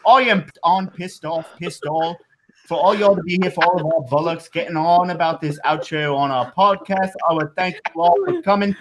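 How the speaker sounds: hammed-up fake British accent. Host giving podcast outro and web address, mock British accent. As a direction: overplay a mock British sign-off. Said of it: "In British accent".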